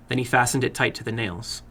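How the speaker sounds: ordinary delivery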